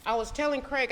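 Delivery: normal talking voice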